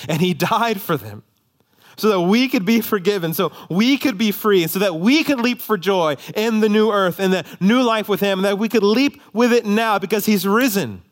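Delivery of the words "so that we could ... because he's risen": high, driving sermon voice; full-force preaching. Acted. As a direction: preach it with rising fervour.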